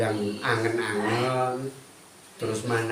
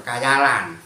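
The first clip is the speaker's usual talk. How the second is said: mocking critics; 5 dB louder, slower than usual. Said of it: "kan ada orang bilangnya gitu".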